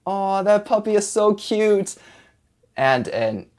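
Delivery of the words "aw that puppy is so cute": high-pitched adoring coo. Shown as a demonstration. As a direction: gush over something adorable. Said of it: "an aw sound, like".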